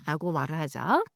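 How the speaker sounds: normal talking voice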